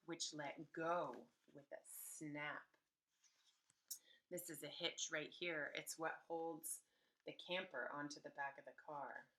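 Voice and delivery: ordinary delivery, nothing marked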